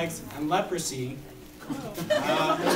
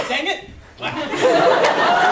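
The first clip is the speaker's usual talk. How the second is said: skydiver curse then impact; 8 dB louder, much slower than usual. Acted. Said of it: "Bad skydiver goes".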